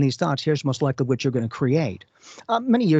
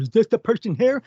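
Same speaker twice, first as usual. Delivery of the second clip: higher, louder, naive newcomer asking; mock innocent question; 9 semitones higher, 4 dB louder than usual. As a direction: play the clueless outsider, all innocence.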